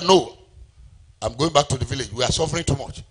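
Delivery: ordinary delivery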